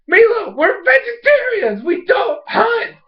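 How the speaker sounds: very high, slow mock protest; exasperated little sister. Ikki to Milo about hunting, hammed-up exasperation. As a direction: stretch each word in hammed-up outrage.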